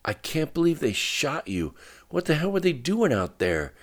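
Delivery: high, tense, incredulous anger; strained, high-pitched indignation; through gritted teeth. A narrator's reading. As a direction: vent outraged disbelief through clenched teeth. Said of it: "gritting his teeth".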